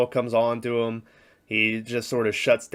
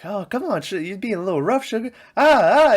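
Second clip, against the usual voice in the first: high-pitched mock protest, rising to louder cries; imitated woman; 10 semitones higher, 4 dB louder than usual. Woman in the car complaining mid-act, imitated in a high voice. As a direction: act out her complaint high, then yelp. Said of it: "you hear, like".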